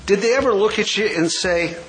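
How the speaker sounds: ordinary talk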